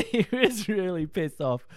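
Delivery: high, loud laughing emphasis; amused and loud. Real, not acted. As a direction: laugh through it.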